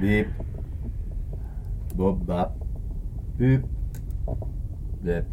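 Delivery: robot beeps; toy robot voice. Acted. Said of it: "j'étais obligé pour la blague".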